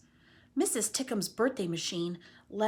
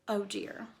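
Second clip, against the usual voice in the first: plain reading voice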